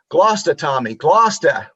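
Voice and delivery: put-on correcting the boy in Boston Irish, Gloucester clipped to Glosta; Boston Irish accent. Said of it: "with that heavy Boston Irish accent".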